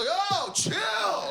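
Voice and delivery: high strangled yelp, held back; stifled scream. Retold or imitated. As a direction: yell without really yelling. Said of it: "you don't wanna scream, but you go".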